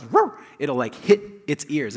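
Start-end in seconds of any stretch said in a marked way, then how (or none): 0.0-0.4 a sudden high, loud mock bark; playful yelp of a barking dog; imitated dog bark. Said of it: "when the dog goes".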